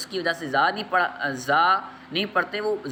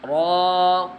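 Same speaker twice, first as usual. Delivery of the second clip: ordinary delivery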